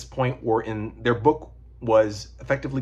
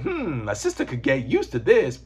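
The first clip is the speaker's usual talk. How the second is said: higher, sassy voice; sassy Layla impression; 6 semitones higher than usual. Layla sizing up Wakanda, mock sassy. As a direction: put on an angry sister's sass. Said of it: "this one's just really angry".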